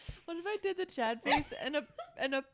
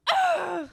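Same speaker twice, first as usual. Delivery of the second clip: sharp loud grunt; tennis grunt; 7 semitones higher, 9 dB louder than usual. Retold or imitated. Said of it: "they're, like, screaming".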